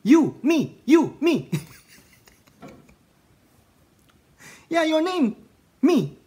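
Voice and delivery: high, loud comic back-and-forth; Rush Hour scene. Retold or imitated. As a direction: play both sides of the movie mix-up. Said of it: "Alam niyo yung sa Rush Hour". (mataas at malakas na paggaya sa eksena ng komedya)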